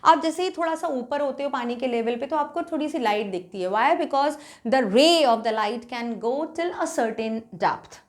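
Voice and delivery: ordinary delivery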